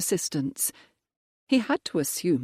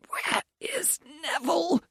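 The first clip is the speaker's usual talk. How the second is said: much higher, strained wheeze; wheezing; 13 semitones higher than usual. Acted. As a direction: squeeze the words out with no air. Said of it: "the Viscount wheezed".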